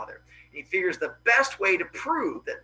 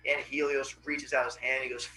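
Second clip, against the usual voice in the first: ordinary delivery, nothing marked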